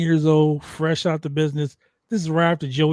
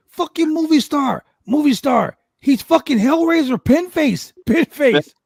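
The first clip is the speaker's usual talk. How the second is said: mock Mexican police officer, high and loud; Mexican cop; 10 semitones higher, 4 dB louder than usual. Storyteller voicing the Mexican cop gawking at Chaos, high and loud. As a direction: imitate the excited cop pointing at the pins, raised pitch. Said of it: "the guy goes".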